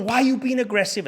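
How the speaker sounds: high-pitched mimicked indignation; worked up. Host accusing guest of aggression, worked up. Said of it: "he got his knickers in a twist".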